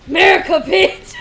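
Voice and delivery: loud mock patriotic yell. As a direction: belt it like a cheer.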